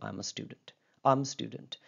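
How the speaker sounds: "I am a student" going down on "student"; falling statement tune. Shown as a demonstration. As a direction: let "student" fall away at the end. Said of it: "अमूमन following के अंदर रहेगी".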